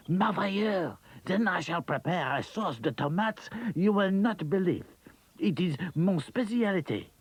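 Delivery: a proud French cook showing off; enthusiastic French chef. Acted. Claude got very enthusiastic.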